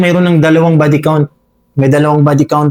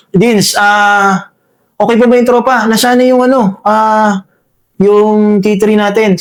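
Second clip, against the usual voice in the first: re-enacted call to a comrade, higher pitched; mildly raised voice, checking on the troop; 6 semitones higher, slower than usual. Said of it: "sigaw ako ng sigaw".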